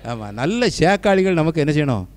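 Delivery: ordinary talk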